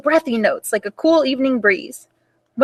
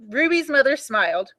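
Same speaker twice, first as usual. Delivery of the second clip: ordinary delivery, nothing marked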